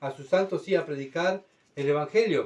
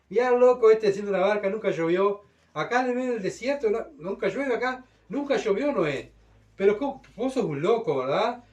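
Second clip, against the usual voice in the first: mocking scoffer; 6 semitones higher, 3 dB louder than usual. Scoffers mock Noah building ark.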